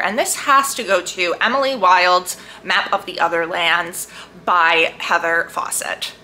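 ordinary talk

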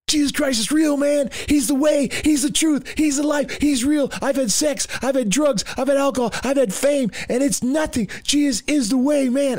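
very high-pitched, fervent testimony. Retold or imitated. Imitating a drummer testifying over hardcore rock radio, high and fervent. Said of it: "I hear on the radio".

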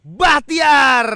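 high, loud shout of a name. Real, not acted. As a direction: call the name out sharply. Sudden shout of player name.

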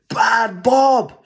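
high, loud telling-off shout; the whole family scolds the dog. A narrator's reading. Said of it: "shouted everyone".

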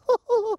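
high-pitched giddy laugh; high giggle. Pretend. Host tickled by George riding the dinosaur, high giggle.

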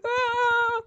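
sudden high yelp of fright; startled scream. Real, not acted. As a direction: jump and shriek. Startled by something in game.

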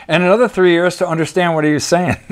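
ordinary delivery

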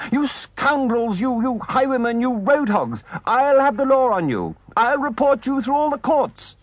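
high, shouted tirade of insults; furious rant. Acted. Rat raging at the car that ran them off, shouting. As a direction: rage at the road hogs.

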